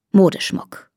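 ordinary delivery, nothing marked

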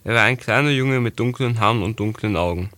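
ordinary delivery, nothing marked

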